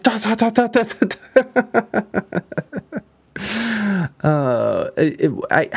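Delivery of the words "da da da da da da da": rapid mock gunfire; automatic rifle noise. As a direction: rattle it off like a machine gun. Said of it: "It's like".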